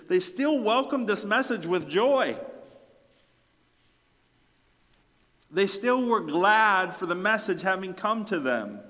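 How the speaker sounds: forceful sermon climbing in pitch; impassioned preaching. Real, not acted. Preacher urging the church how valuable the gospel is, impassioned and building.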